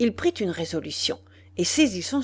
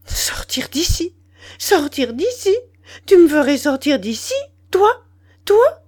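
high, incredulous questions, growing louder; stunned and furious, each question higher and sharper; stammering disbelief; 8 semitones higher, 7 dB louder than usual. A narrator's reading. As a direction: echo the threat in choked outrage, building. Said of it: "Elle balbutia".

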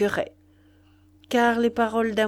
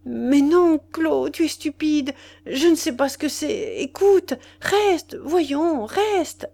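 much higher, flustered stammering plea; ashamed pleading; 7 semitones higher, 4 dB louder, slower than usual. A narrator's reading. Lover begging his mistress to stay, higher and flustered. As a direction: beg her to stay, caught out and ashamed. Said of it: "honteux et balbutiant".

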